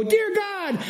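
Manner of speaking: high-pitched, hammed-up call to God; mock loud prayer. Pastor showing how not to pray in a meeting, mock loud prayer. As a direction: cry out in mock public prayer. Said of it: "I don't mean go".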